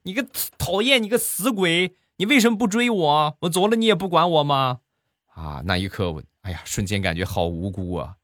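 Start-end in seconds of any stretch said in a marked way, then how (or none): 0.0-4.8 much higher, coquettish mock-angry wife voice; a pouting wife scolding her husband in a high squeal. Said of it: "她一边踢一边很生气". (撒娇又生气的媳妇儿，尖声娇嗔)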